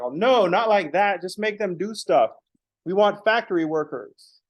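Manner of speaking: dismissive bosses. Retold or imitated. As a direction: lampoon a clueless manager.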